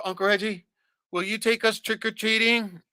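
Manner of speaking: put-on high, pleading little kid voice; begging kid. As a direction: mimic a child asking politely.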